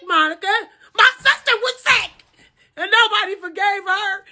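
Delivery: high, exaggerated nagging plea; mocking pleading. Everybody telling Monica to forgive, mocked in high voice. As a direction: mimic them in a high, insistent singsong.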